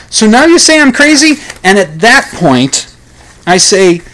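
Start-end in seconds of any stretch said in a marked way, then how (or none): 0.1-1.4 high, indignant outburst; indignant teen rant. Acted. Teenager railing at his parents, high and indignant. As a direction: fire back at the parents.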